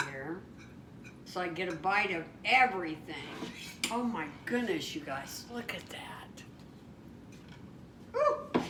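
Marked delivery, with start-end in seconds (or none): 3.9-6.4 awed whisper. Real, not acted. Awed by the finished plate. 8.1-8.6 high startled woo; surprised whoop. Real, not acted. The plate is hot.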